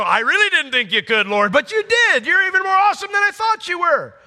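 high, loud amazed praise; an angel bowled over with wonder; gushing angel. Pretend. Angel telling the Lord of its surprise, high gushing.

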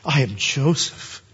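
slow, measured declaration; slow revelation. Acted. Joseph reveals himself to his brothers, slow and measured. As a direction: say it slowly, letting each word land. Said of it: "Joseph just blurts out the reality".

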